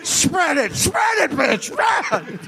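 high shouted sleazy producer. Acted. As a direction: bark it like a crass director.

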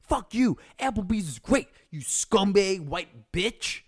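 high, loud mock shout of a protester; angry protester yell. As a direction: yell it like an angry crowd outside your house. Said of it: "there's gonna be protests".

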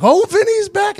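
high, delighted team cheer; excited cheer. Retold or imitated. Teammates greeting their kicker with a high excited cheer. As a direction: cheer him back onto the field.

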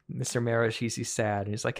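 usual speaking voice

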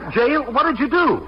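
high-pitched alarmed questioning; startled disbelief. Acted. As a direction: pitch jumps up in dismay. Learns uncle is jailed.

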